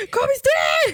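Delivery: high, loud shocked cry; shocked shout. Retold or imitated. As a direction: blurt it out in shock. Mimicking friend's shock.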